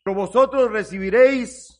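loud, emphatic Bible quotation; declaimed scripture. Acted. Preacher quoting the Lord's promise to his congregation, loud declamation. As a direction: proclaim the verse with force.

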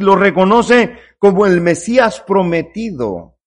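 ordinary talk, nothing marked

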